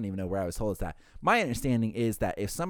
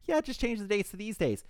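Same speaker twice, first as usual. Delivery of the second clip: much higher, sweet mom voice; lovely mom; 11 semitones higher than usual. Retold or imitated. Guest's mom agreeing to change the dates, lovely high voice. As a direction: imitate a lovely, agreeable mother on the phone. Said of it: "The mom sounds lovely".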